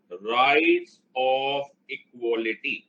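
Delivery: slow chanted call; drawn-out call. Shown as a demonstration. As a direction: stretch it out for the class to chant. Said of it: "रट लो अभी के अभी".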